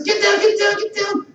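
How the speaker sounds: high, urgent repeated warning; frightened mother. Retold or imitated. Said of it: "she was frightened".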